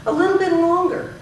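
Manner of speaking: lifted phrase ending on a long "longer"; held last word. Shown as a demonstration. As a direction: hold the syllables of "longer" before pausing. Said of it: "holding some of my syllables".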